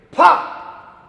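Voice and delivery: loud, high pop; sound effect pop. Acted. Turnip finally pops out.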